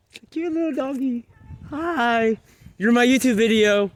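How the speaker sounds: high, cooing voice for a puppy. Real, not acted. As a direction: coo at the little doggy. Talking to a cute dog.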